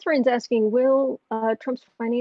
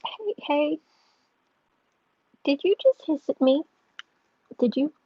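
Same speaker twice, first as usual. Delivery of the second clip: startled scolding; 5 semitones higher than usual. Real, not acted. Owner startled that her cat hissed, indignant raised voice. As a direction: call out in surprised disbelief.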